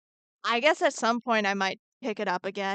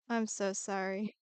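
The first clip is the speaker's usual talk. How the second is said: normal talking voice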